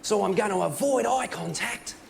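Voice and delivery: slow, swooping broad Australian wildlife presenter; drawn-out, intense hushed-excitement nature host; Steve Irwin. Acted. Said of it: "he just looked down the camera and went".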